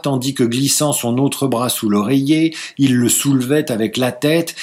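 ordinary delivery

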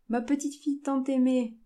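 proud, high, loud greeting; joyful exclamation. A narrator's reading. Grandfather greets his beloved granddaughter, crying out proudly. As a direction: exclaim with delight, voice lifted. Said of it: "s'écria-t-il".

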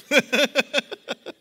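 big bright burst of laughter; loud laugh. Real, not acted. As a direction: laugh out at your own joke. Laughs at own joke.

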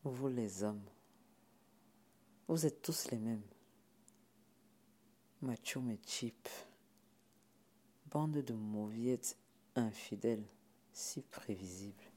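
slow, low, soft contempt; weary disdain. Acted. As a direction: let each insult drop slowly. Sipora scorns men after sucking teeth. (mépris las, lent et grave)

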